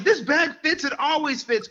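heated insistence. Retold or imitated. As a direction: push back hard, high and heated. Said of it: "I was getting heated".

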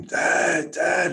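high, imitated child's yell for Dad; retold scream. Father imitating his son calling him at the campsite, mock scream. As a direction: mimic a son calling out in alarm. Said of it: "I hear him scream".

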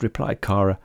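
ordinary delivery, nothing marked

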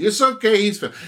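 passerby calling out to reassure about her dog; reassuring woman. Retold or imitated. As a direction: sing out that the dog means no harm.

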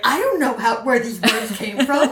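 slow, emphatic mock bewilderment; exaggerated childlike cluelessness; baffled kid. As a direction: imitate a child disowning the homework. Child baffled by sight words.

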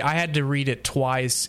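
usual speaking voice

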